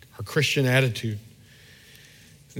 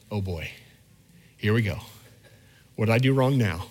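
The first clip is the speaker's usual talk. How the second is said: weary dread; 4 semitones lower than usual. Retold or imitated. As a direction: groan inwardly, expecting trouble. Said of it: "here's the attitude of my heart".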